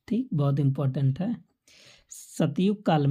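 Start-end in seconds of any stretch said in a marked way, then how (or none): none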